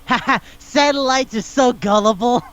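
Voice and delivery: a hint of a cartoon villain sneering to himself; smug villain. Villain gloating to himself, retold.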